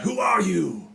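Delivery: high, loud in-character shout; yelled challenge. Acted. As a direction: yell the question across at a stranger. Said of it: "And I yell out".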